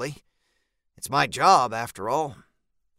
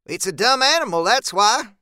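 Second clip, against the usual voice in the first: irritated, high and raised; testy higher-pitched character voice; snapping; 6 semitones higher, 4 dB louder than usual. A narrator's reading. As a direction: snap back with impatience. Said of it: "Zeb snaps".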